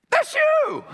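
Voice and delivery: very high, loud squeal of delight; exuberant exclamation. Real, not acted. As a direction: burst out at the congregation in falsetto. Preacher exclaims to the congregation.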